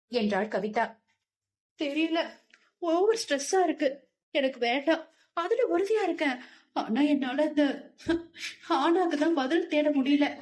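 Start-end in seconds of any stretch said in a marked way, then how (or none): 1.8-10.3 a touch of tears, pitched higher; lightly voiced girl close to crying; tearful. A narrator's reading. Girl to her friends, explaining through tears, mildly tearful. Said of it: "இப்ப எதுக்கு அழுகுறன்னு".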